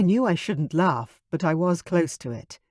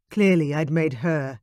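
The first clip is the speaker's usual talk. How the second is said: ordinary delivery, nothing marked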